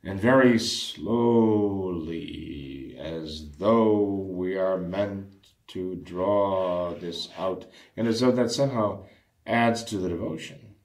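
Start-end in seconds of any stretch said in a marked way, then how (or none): 0.0-7.7 very slow, drawn-out mock-solemn delivery; dramatically slow. Shown as a demonstration. Priest mimicking those who drag out the rosary, dramatically slow. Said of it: "say it very dramatically".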